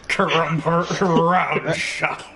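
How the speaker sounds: slowly savoring the name, laughing. Real, not acted. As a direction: drag out the name with a chuckle.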